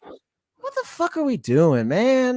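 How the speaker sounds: real exasperation, voice climbing high at the end; exasperated. Real, not acted. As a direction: plead in disbelief, drawing it out. Exasperated rant about the race officiating.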